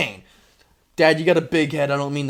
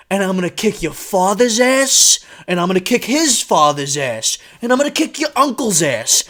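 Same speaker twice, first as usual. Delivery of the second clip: menacing threat; 3 semitones higher, 4 dB louder than usual. Retold or imitated. Dad threatening the bully.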